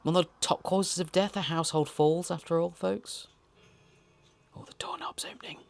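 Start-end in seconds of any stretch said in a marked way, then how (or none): 4.5-5.6 hushed whisper, very soft; suspenseful whisper. Real, not acted. Host watching the doorknob turn in the film, whisper.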